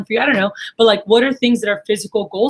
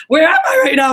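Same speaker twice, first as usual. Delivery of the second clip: higher and louder, bursting with excitement; thrilled disbelief; 7 semitones higher, 6 dB louder than usual. Retold or imitated. To herself while lost in Israel, thrilled excited cry. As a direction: blurt it out like you can't believe where you are.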